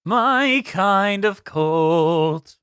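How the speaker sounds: drawn out. Pretend. Repeats title slowly, savouring it.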